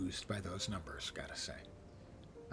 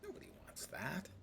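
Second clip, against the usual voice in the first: whispering; 5 semitones higher, 12 dB quieter than usual. Real, not acted. Whispered aside.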